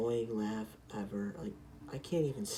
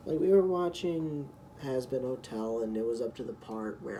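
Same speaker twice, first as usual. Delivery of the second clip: ordinary talk, nothing marked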